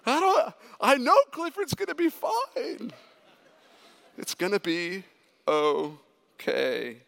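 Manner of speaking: very high, hammed-up tearful wail, easing at the end; mock crying. Dad bawling over a Clifford movie, mock crying. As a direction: imitate bawling through your own reassurance. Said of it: "the whole time I'm crying".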